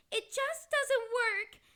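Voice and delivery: loud, fast panicked cry; frantic wail. Acted. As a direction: wail it in despair. Despairing over Kaz's hiccups.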